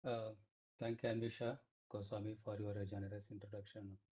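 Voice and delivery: barely audible, low level; very quiet. Real, not acted. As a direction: speak very low, almost inaudible. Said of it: "Your voice is very low".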